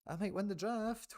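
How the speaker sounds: high, teasing sing-song repeat; gleeful sing-song. Real, not acted. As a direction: sing it out, delighted with yourself.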